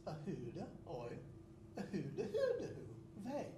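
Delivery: sing-song made-up Swedish with a laugh; mock Swedish oy vey. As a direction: chant nonsense Swedish playfully. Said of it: "It's like this".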